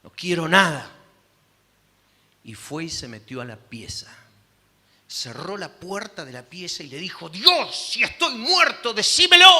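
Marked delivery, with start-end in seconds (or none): 0.0-0.9 angry refusal. Retold or imitated. Marido rechaza la comida. 7.3-9.5 much higher and much louder, rising to a shout; desperate cry to God. Retold or imitated. Marido clama a Dios encerrado.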